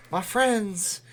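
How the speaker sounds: a hint of an evangelical preacher calling out; fiery preacher sermon. Shown as a demonstration.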